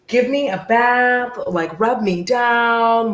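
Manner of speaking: slow, drawn-out demand, as the dog; pampered dog. Retold or imitated. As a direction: luxuriate in every word like a spoiled pet. Voices pampered dog demanding bath.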